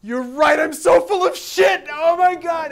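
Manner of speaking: high, loud shout of sudden insight; high, loud overwhelmed outburst; shocked realization. Retold or imitated. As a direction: cry out as it dawns on him. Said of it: "he's like".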